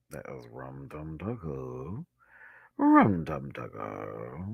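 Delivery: slow, low, stretched syllables; playfully drawn out. Real, not acted. Vlogger insisting it was the cat character, playfully drawn out. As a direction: draw the name out slowly and low.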